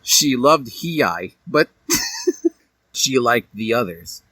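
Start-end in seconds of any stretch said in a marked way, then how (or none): none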